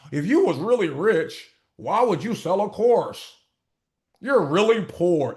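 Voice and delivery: slow, mocking taunt; mocking critics. Mimicking haters who doubt his wealth, slow mocking voice. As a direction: drag it out like a smug hater. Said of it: "they be like".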